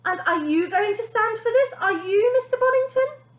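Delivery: very high, loud challenge; nanny challenging the father; calling up. Acted. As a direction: call up the stairs. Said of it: "called up to the father".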